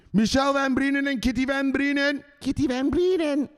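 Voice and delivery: high-pitched name call; hammed-up high holler; Van Breden yell. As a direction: call out the names in a high put-on voice. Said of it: "Even the Van Breden yells".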